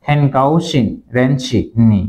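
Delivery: হ্যানকাওশিন রেনশিনি drawn out slowly, each syllable clear; slow careful repeat. Shown as a demonstration. Teacher repeats the last Chinese phrase for viewers, very slowly. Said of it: "last শব্দটা আবার বলছি".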